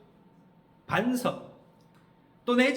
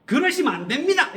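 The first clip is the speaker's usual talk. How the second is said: raised-voice, high-pitched telling-off; acted scolding; 7 semitones higher, 7 dB louder than usual. Peter pulls Jesus aside to tell him off, loud rebuke. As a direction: forbid it loudly, browbeating the listener. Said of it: "그러면서 막 야단을 쳐요".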